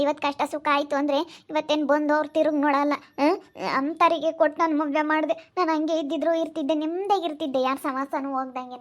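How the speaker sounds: usual speaking voice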